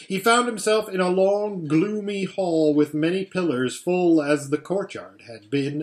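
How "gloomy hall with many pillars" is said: ordinary delivery, nothing marked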